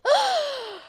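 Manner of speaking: sharp high shocked gasp; offended gasp. Retold or imitated. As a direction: gasp as if deeply insulted. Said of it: "I was so offended".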